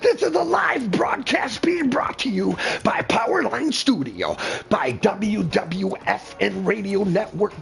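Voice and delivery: high, hammed-up broadcast voice; radio announcer. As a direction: sell it like a live broadcast.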